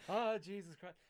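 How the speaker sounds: loud, pained "oh"; emotional exclamation. Retold or imitated. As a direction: blurt it out, moved. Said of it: "And I was like".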